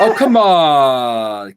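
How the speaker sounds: long, drawn-out plea; drawn-out protest. Real, not acted. Pleading with friend who refuses to wear lederhosen, drawn-out protest. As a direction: drag out the words in protest.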